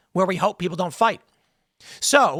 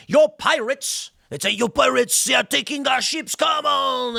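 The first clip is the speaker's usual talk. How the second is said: mock accusation, higher, rising to a shout at the end; accusing France; 6 semitones higher, slower than usual. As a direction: play France calling England's bluff, ending in a shout. France accusing England, acted quote.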